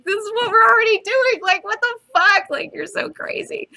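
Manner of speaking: high-pitched, incredulous protest; exasperated disbelief. Acted. Night Owl answers Rorschach's question about the underworld, exasperated disbelief. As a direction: voice shoots up in mock exasperation.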